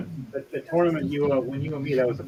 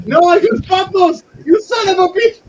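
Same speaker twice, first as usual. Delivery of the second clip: much higher, much louder frantic shouting; frantic yell; 8 dB louder than usual. Acted. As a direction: scream it like a kid losing a game. Elf yelling while smashing pots.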